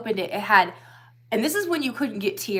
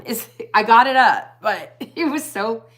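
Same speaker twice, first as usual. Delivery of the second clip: laughing; 3 semitones higher, 8 dB louder than usual. Real, not acted. Laughing retelling choking on food.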